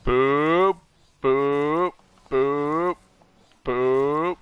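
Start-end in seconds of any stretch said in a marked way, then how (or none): none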